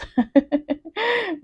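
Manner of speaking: short high laugh; amused high-pitched laugh. Real, not acted. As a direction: laugh lightly mid-explanation. Laughs at nothing to stress.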